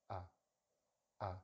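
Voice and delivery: a short, relaxed neutral "uh"; schwa sound. Shown as a demonstration. Teacher demonstrating the most common English sound, relaxed schwa. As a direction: say "uh" with lips and jaw slack. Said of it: "it's a very quiet sound".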